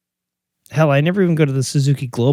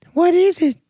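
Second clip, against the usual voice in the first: put-on high, louder girlish question; Pulp Fiction girlfriend; 4 dB louder than usual. Girlfriend asking her boyfriend, high and eager. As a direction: squeal the question like a curious girlfriend. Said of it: "That's, that's Pulp Fiction".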